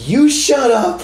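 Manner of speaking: put-on high, loud little-kid retort; a tiny kid firing it back at a drunk; little girl talking back. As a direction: toddler in a stroller shouting back at a drunk. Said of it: "she goes".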